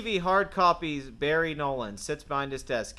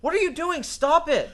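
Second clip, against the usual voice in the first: panicked shout; 8 dB louder than usual. Acted. Panicked protest at glass.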